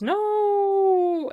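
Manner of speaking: long, high, loud wail; mock scream. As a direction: draw it out into a theatrical high scream. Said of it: "the man is screaming just".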